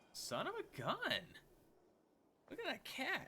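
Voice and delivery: high, amazed outburst at the screen; excited. Real, not acted. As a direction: yell it out, thrilled by the fight. Watching a cat fight a snake.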